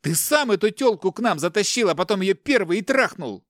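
high, angry accusing yell; furious roar. A narrator's reading. Captive son throws blame at his brother, roaring. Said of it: "заревел Игорь".

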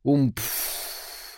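airy, voiceless 'oomph' of an explosion; imitated muffled blast. A narrator's reading. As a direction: puff out the sound of a blast. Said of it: "с гулким". (глухой выдох, как звук взрыва)

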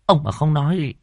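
high, angry warning to the husband; first angry warning. Acted. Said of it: "hỏi to".

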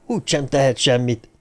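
plain reading voice

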